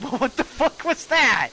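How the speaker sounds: loud, high-pitched incredulous yell; mock baffled outburst. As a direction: burst out in exaggerated disbelief. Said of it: "and it's like".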